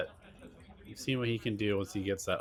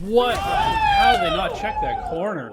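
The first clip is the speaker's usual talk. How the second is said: much higher, loud stunned outburst; can't believe what just happened; shocked disbelief; 9 dB louder, slower than usual. Real, not acted. Shocked by a missed corner.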